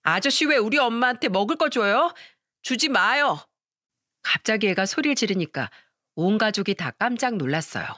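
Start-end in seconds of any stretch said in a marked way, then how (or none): 0.0-3.4 put-on a jealous five-year-old yelling at the man; lightly voiced little boy yelling in protest; child yelling. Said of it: "냅다 소리를 지르면서".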